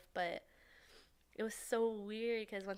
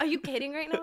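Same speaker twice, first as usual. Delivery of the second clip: loud, higher incredulous question; retold disbelief; 7 semitones higher, 8 dB louder, faster than usual. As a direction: call out a friend in mock shock. Retold disbelief at drugged driver.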